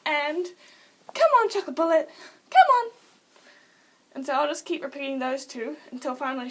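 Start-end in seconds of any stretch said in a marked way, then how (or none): 1.1-2.9 high, loud urging call; excited-sounding high pet call; pet command call. Shown as a demonstration. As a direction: coax the pet over, loud and pitched up. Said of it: "say it in the exact same tone".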